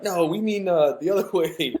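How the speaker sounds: high-pitched retold correction, breaking into a laugh; amused correction. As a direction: imitate an amused "no, no", laughing midway.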